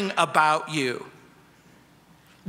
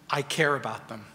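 calm Jesus; 7 semitones lower, 6 dB quieter, faster than usual. Acted. Jesus' calm answer voiced.

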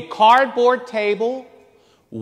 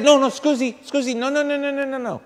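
higher pitched, hurried Italian pleading of no, no, scusi; Italian protest; 5 semitones higher, 4 dB quieter, faster than usual. Retold or imitated. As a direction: insist while fading softer. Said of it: "And I said".

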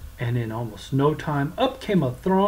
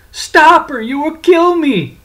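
high loud pleading cry; shouting; 13 semitones higher, 11 dB louder than usual. Acted. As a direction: beg at the top of your voice. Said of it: "cried, cursed".

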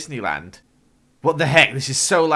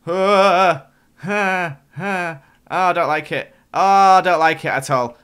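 higher and louder, distressed cries; real fright; 5 semitones higher, 8 dB louder than usual. Real, not acted. Gamer facing walls closing in, crying out in real fright. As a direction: fret aloud in claustrophobic fear. Narrowing walls, worst nightmare.

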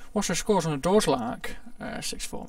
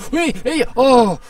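sudden loud shout, higher pitched; startled shout; 7 semitones higher, 13 dB louder, slower than usual. Real, not acted. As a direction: yell out in real shock. Sudden shock in the game.

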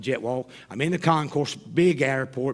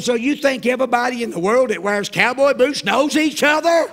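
loud, high comic indignation; incredulous outburst; 9 semitones higher, 8 dB louder than usual. Acted. Passenger answers flight attendant's cowboy-boot logic, incredulous. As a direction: blurt it out in disbelief, building to the end.

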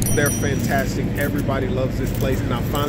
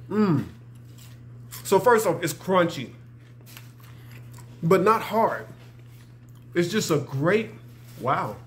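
speaking between bites; chewing while talking; 2 semitones higher, 3 dB quieter than usual. Real, not acted. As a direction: mouth full of food. Seen 0: Bites fish at -1.0, then chews with closed, bulging mouth throughout.

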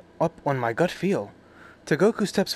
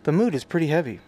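normal talking voice

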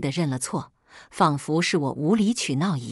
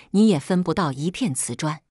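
ordinary delivery

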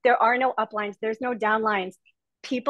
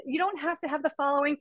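ordinary talk

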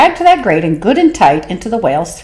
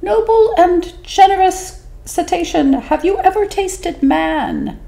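much higher, slow and sly little fish; small 'stute voice; 13 semitones higher than usual. Acted. Small fish flattering the whale, high and sly. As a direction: squeak it up small and cunning. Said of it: "said in a small, 'stute voice".

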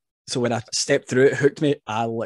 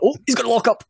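mock excitement; 12 semitones higher, 5 dB louder, faster than usual. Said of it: "you're like".

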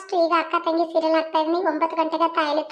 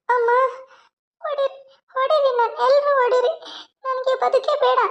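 higher, sobbing protest; acted crying; 7 semitones higher than usual. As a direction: wail that she wants to die. Beaten daughter cries out.

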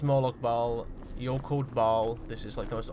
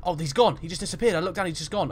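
loud, higher-pitched startled reaction; rattled, raised voice; spooked; 5 semitones higher, 7 dB louder than usual. Pretend. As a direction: blurt it out in alarmed disbelief. Figure vanishes suddenly in game.

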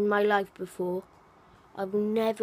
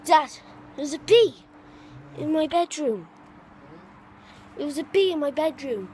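raised alarmed voice; calling out; 4 semitones higher than usual. Real, not acted. Calling dad about bee.

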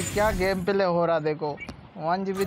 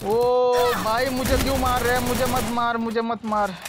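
loud, high-pitched protest; urgent pleading; 5 semitones higher, 4 dB louder than usual. Real, not acted. Player begging an attacker not to kill, urgent pleading. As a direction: drag out the opening cry, then plead higher.

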